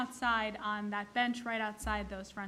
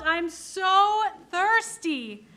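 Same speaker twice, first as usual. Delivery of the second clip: higher, louder, slow drawn-out "I'm so thirsty"; loud kvetching; 7 semitones higher, 8 dB louder, much slower than usual. Retold or imitated. Old man complaining to the helper, loud theatrical kvetch.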